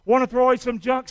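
ordinary talk

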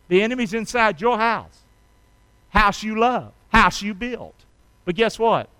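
usual speaking voice

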